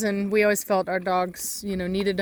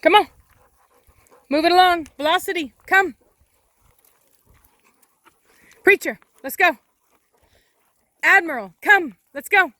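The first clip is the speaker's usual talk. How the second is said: louder and higher dog recall; calling the dogs; 8 semitones higher, 5 dB louder than usual. Real, not acted. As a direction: summon each dog by name.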